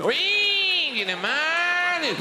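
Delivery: high, slow, drawn-out Australian whinge; mock Australian rant. Comic impression of Australians mocking the English, high slow Australian drone. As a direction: lay on a broad Australian accent and stretch every word. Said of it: "we call you".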